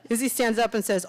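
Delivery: ordinary delivery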